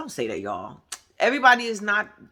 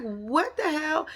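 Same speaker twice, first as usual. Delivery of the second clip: higher, slow disbelieving what the hell; stunned what the hell; 8 semitones higher, 4 dB quieter, slower than usual. Retold or imitated. Reacting to the two friends yelling, high and slow. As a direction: drag it out in disbelief. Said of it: "I was like".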